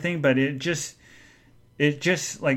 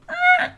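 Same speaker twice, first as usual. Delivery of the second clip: sharp squeal, much higher; mock high gasp; 4 dB louder than usual. Boss suddenly exclaiming at Tom, with a high yelp. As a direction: yelp it out like a startled cry. Boss's sudden outburst, retold.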